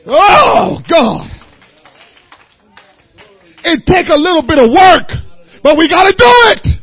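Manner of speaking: loud, rising preacher's cadence; preaching. Acted. Preacher urging his congregation, loud and rising. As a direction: preach it with growing force.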